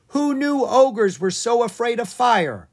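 high, loud yell across the room. Acted. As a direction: call out to his sister. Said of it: "Owen yelled to his sister".

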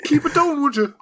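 hammed-up irritated request; shushing the funeral from the podcast, high; mock shush. Voicing annoyed podcaster.

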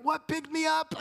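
put-on frightened child. Preacher voicing a child picked up in the dark, high. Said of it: "they're still crying 'cause they're like".

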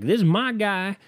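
high, put-on Southern voice; Southern supporter. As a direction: boast in a hammed-up Southern accent. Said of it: "if I coulda just done this voice".

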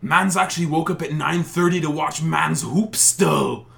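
loud, slow, high roadman slang; Toronto roadman slang. Retold or imitated. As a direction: hype it up like the coach. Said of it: "he's like".

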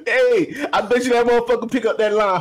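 ordinary talk, nothing marked

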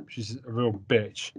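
ordinary delivery

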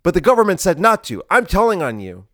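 high, loud tattling whine; childish threat. Retold or imitated. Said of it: "the liberals would go".

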